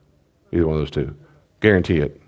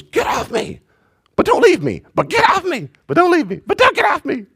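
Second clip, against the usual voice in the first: put-on very high, louder whiny baby voice; confused toddler; 3 dB louder than usual. A baby protesting to his dad, very high and whiny. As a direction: whine back and forth like a torn little kid.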